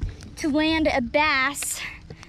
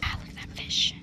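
awed whisper; 7 dB quieter, faster than usual. Real, not acted. Angler admiring the smallmouth, in an awed whisper. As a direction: whisper as if the fish might hear.

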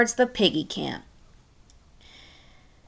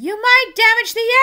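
shouting; 11 semitones higher than usual. Acted. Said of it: "Red shouted".

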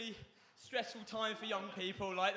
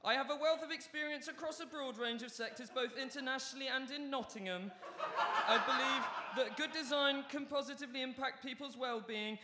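measured, higher-pitched formal self-introduction; bio read-out; 6 semitones higher than usual. Acted. Comedian recites an architect's bio as his own, formal read-out. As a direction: recite a professional biography evenly.

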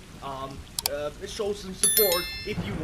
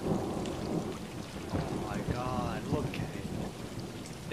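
awed; 6 semitones lower, much slower than usual. Real, not acted. Awed by the giant moon.

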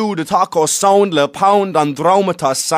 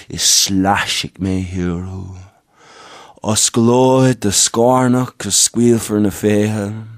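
solemn spoken-word recital; 9 semitones lower than usual. Acted. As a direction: lower the voice and draw the lines out. Slow solemn address in performance.

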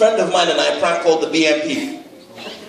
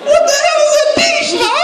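laughing. Real, not acted. Laughing disbelief.

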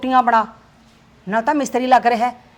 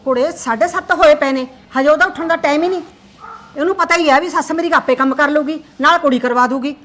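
loud complaint; 8 dB louder than usual. Acted. Mother complaining loudly to her son that it is already half past seven. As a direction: hammer out the time, scolding.

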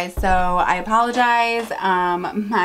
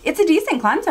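exasperated; 9 semitones higher, 5 dB louder, faster than usual. Real, not acted. Repeats earlier verdict, exasperated.

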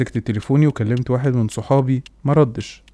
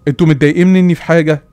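raised voice, calling each one in turn; loud pleading; 3 semitones higher, 6 dB louder than usual. Retold or imitated. Son calling to his father, mother and sister, loud and desperate.

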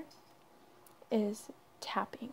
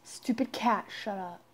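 loud outburst, dropping lower at the end; annoyed scolding; 3 semitones lower, 7 dB louder than usual. Real, not acted. Host telling off the noisy cat, annoyed. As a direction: break the hush to snap at a pet.

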